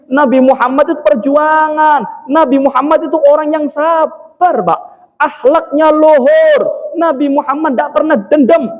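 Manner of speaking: high, forceful sermon delivery; exhorting the congregation at a high pitch; impassioned preaching. Real, not acted. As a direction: drive each point home from the pulpit.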